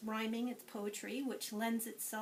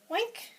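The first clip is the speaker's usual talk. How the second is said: much higher, loud oink; pig oink; 12 semitones higher, 7 dB louder than usual. Shown as a demonstration. Teacher showing children the pig's sound, high oink. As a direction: squeak out a little pig's oink. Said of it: "and the little pig says".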